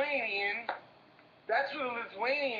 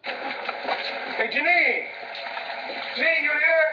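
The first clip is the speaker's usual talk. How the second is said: loud, raised shout for Janine; calling out; 6 semitones higher, 7 dB louder, slower than usual. Acted. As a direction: holler to find out if someone's there. Calling out for someone at her place.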